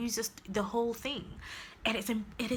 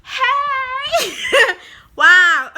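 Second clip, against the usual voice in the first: much higher, much louder laughing squeal; overjoyed squeal; 13 dB louder, slower than usual. Real, not acted. Reviewer overcome with delight over the book, squealing. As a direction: lose it with delight.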